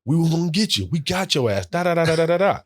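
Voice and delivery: high-pitched gloating teammate voice; bragging taunt. Retold or imitated. Teammate taunting rookie over the bill, high gloating. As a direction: rub it in, crowing. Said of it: "bragging now".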